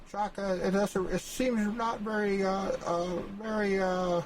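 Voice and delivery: lightly voiced high whiny critic; high mocking voice. Map-maker voices a doubtful rival in a high whine. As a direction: pitch it up as a skeptical neighbor.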